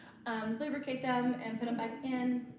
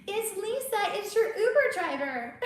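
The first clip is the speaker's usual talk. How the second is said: laughing quote; 9 semitones higher, 5 dB louder, slower than usual. Retold or imitated. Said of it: "And she goes".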